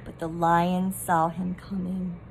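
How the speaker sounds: ordinary delivery, nothing marked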